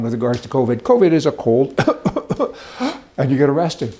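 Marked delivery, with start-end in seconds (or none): 1.8-2.5 put-on string of coughs; exaggerated fake cough; mock coughing. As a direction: cough for show, like a cold. Acting out covid as a cold.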